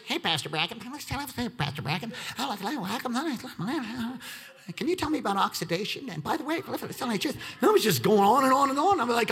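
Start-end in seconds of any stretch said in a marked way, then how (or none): 0.0-7.4 put-on eager kid. Mimicking an eager awkward kid.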